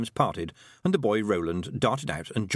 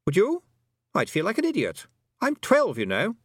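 put-on high, indignant boy's voice; boy character, indignant; 10 semitones higher, 4 dB louder than usual. A narrator's reading. Roland protesting he is twelve, indignant high boy.

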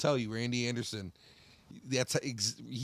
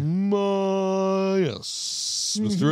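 high, put-on old teacher voice; a lofty schoolteacher's drawn-out yes; teacher impression; 8 semitones higher, 8 dB louder, much slower than usual. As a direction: imitate the stuffy old teacher. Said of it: "I swear that's how he talked".

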